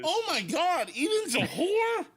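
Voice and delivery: high, slow, astonished cry; shocked outburst. Acted. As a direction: gasp it out in disbelief. Robot shocked discovery.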